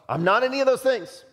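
emphatic denial. Acted. Acting John rejecting false identities.

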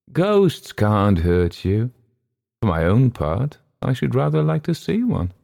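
lightly voiced old gentleman, dismissive; a scoffing old gentleman, pitch climbing. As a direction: brave talk from an old gentleman.

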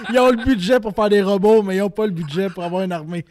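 laughing. Real, not acted. Laughing about the army budget.

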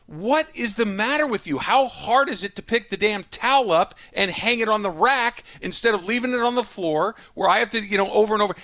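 put-on high, loud exasperated rant at the kid; parent's angry yell. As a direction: scream it like a dad who's lost it. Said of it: "And you scream and you yell".

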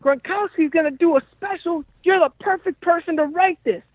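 very high-pitched old-school character impression; Henry. Old-school manager pitching a job to his comedian friend, high caricature voice. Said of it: "He talks like this. He's like".

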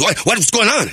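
lightly voiced dad demanding answers; startled dad. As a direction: play a dad butting in. Overhearing dad bursts in with questions.

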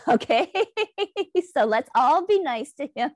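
real amused laugh running into the words. Real, not acted. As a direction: laugh through a teasing welcome. Host jokes about man joining.

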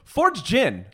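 loud, high radio ad voice; ad read. Acted. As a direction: announce it like a hammy commercial. Mock sponsor ad read.

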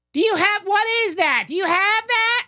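put-on high, ditzy drunk girl voice; mock a tipsy girl at the bar. Mocking drunk girls at a dive bar, high ditzy voice. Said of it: "they're like".

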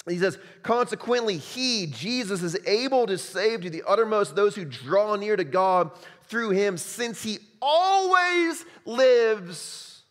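loud, high, fervent pulpit delivery; loud, high preaching voice; full-force preaching. Acted. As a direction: proclaim it to the whole room.

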